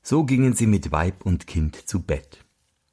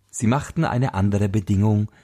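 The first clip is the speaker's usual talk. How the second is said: plain reading voice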